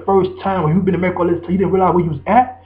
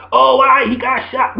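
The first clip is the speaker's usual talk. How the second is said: slow, high mocking whine; mocking whiner; 7 semitones higher, 3 dB louder, slower than usual. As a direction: drag it out like a whiny fool. Mocking the complainer.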